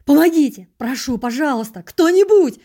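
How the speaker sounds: loud, high cries for help; desperate yelling. A narrator's reading. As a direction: yell as if being cut alive. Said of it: "орал я так".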